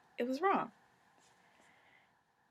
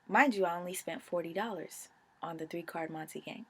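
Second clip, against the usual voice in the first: ordinary talk, nothing marked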